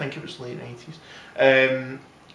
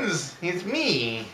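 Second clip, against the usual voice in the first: slow, drawled Dylan voice; lightly done folk singer drawl; [name] impression; 4 semitones higher, 4 dB quieter, much slower than usual. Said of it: "Right, normal voice".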